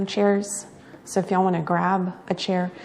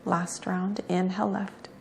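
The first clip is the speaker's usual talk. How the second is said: soft, unhurried yoga-teacher cues; guided breathing meditation; 10 dB quieter than usual. Real, not acted. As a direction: guide each breath quietly, with long pauses between cues.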